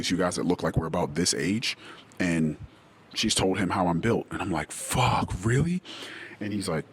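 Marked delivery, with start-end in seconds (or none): 4.7-5.8 stunned disbelief. Retold or imitated. Retold reaction to his friend, stunned and rising. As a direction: let it shoot up, floored by the news. Retelling shock at friend's news.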